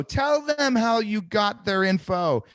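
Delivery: high, slow, hammered word by word; emphatic urging. Real, not acted. Coach telling scared agents to answer plainly, exasperated emphasis.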